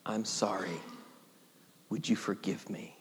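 hushed, soft whispered apology; whispered plea for forgiveness. Shown as a demonstration. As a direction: breathe it out as a confession.

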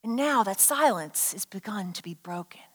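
low, soft near-whisper; quiet, low storyteller's hush; hushed reveal. Real, not acted. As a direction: hush it down for suspense. Hushed reveal of silence ending.

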